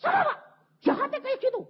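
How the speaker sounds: high, loud furious outburst; angry husband. Retold or imitated. Husband demanding who hurt his wife, high angry shout. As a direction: shout it like a husband seeing red. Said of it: "Jadi dia marah".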